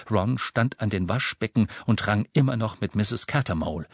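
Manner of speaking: ordinary delivery, nothing marked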